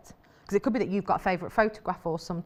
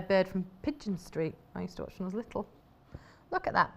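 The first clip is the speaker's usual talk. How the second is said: ordinary talk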